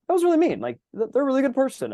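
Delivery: high, guilty little inner voice. Retold or imitated. Conscience scolding him for gossiping about a friend, high guilty voice. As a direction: let the conscience squeak out its reproach. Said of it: "you're going".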